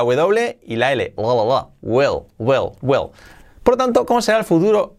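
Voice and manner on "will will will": will said like wel, e vowel, L at end. Shown as a demonstration. Teacher drilling will as wel with an e vowel. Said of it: "La E como una E".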